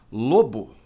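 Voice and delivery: ordinary talk, nothing marked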